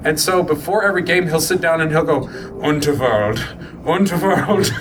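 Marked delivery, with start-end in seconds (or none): 2.6-4.7 imitated amateur German accent. Player getting into character before a D and D game, German accent. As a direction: mimic a gamer's German accent mantra. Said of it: "has to do a German accent".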